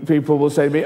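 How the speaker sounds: ordinary talk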